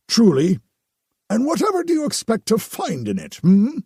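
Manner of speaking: overly devout priest; pious priest voice. Acted. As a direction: gush with relief like a holy man. Narrator voices the Potter.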